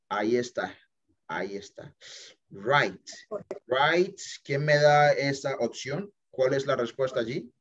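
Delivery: ordinary talk, nothing marked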